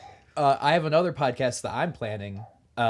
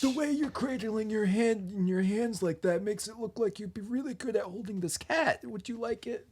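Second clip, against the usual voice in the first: higher-pitched silly character voice; put-on new voice; 4 semitones higher than usual. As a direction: play a goofy character, pitched up. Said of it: "I really enjoy this new voice".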